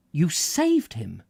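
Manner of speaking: slow, firm and emphatic. A narrator's reading. Mrs Jan Bill assuring Squales, slow and emphatic. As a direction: insist on every word, slowly. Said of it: "replied emphatically".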